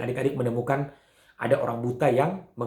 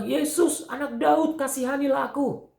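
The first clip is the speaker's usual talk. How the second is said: acted shouting; 11 semitones higher than usual. Blind beggar shouting to Jesus for mercy, calling out. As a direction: cry out for mercy in a raised, high voice. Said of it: "dia berteriak kepada Tuhan Yesus".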